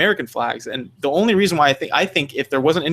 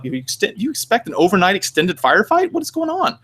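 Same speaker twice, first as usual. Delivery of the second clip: climbing into high-pitched bafflement; hammed-up, rising incredulous questioning; mock incredulity; 6 semitones higher than usual. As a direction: let the question shoot up in disbelief. Mock disbelief at over-armed posers.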